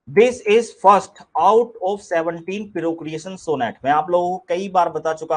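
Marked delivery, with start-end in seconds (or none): 0.1-3.7 slow deliberate reading. Shown as a demonstration. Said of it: "teacher announces slide title".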